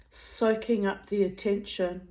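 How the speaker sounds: usual speaking voice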